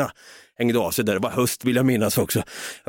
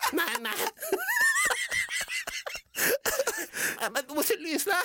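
mock sob-wail, much higher and softer; desperate plea; 9 dB quieter than usual. Son begs his mom to listen, hammed-up wailing. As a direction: whimper it like a frightened kid on the verge of tears.

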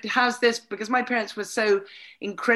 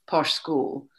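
usual speaking voice

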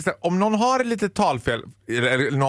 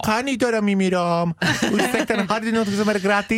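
higher, put-on Persian-Swedish accent; mock Persian accent; 6 semitones higher than usual. Mocking how Persian women sound, put-on Persian accent. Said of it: "De låter ju mer såhär".

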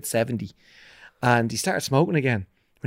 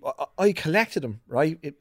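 ordinary talk

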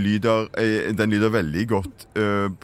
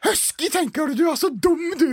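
much higher, squeaky greeting; high silly mother voice; faster than usual. Acted. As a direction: play the mum as a high cartoon lady.

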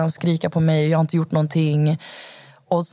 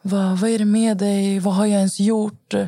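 higher, drawn-out imitation of feigned hurt; mock-wounded pleading, slow and raised; playing sad; 4 semitones higher than usual. Boyfriend calls his crying pregnant girlfriend, playing sad. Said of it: "han leker helt så här ledsen".

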